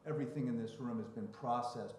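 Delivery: ordinary delivery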